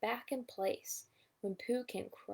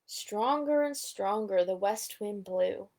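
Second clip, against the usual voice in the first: usual speaking voice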